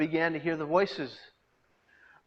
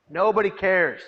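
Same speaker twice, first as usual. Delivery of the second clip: much louder, anguished cry; despairing outburst; 5 semitones higher, 8 dB louder than usual. Retold or imitated. Inner thought of a despairing young man, anguished and louder. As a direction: burst out in despair. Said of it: "I thought".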